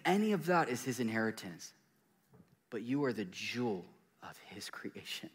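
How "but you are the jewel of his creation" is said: whispered, tender. Acted. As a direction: breathe it intimately. Tender climax of sermon.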